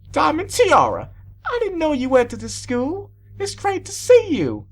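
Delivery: very high, gushing greeting; delighted teacher. A narrator's reading. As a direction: greet an old pupil with delight. Delighted teacher greets former pupil.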